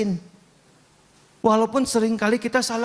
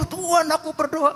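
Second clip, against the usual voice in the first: imitating a revival-meeting prayer, high-pitched cry; mock fervent, high prayer call; doa gaya KKR; 8 semitones higher than usual. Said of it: "Kalau saya kayak K K R, doanya". (doa berseru ala KKR)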